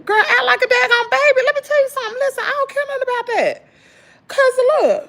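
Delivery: high, loud exasperated rant; high pitched, fed-up venting. Real, not acted. Ranting to viewers about husband.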